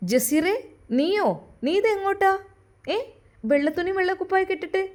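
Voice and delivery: a mother who can't believe her eyes; startled mother. Acted.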